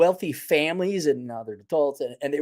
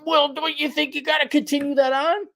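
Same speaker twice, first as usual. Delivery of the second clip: mock question; 11 semitones higher than usual.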